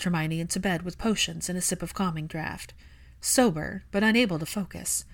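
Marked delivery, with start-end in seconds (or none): none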